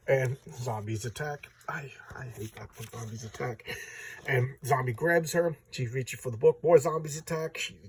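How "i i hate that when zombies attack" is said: breathy whisper, half-joking; whispered aside. Real, not acted. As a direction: whisper it like a secret complaint. Joking aside about zombies.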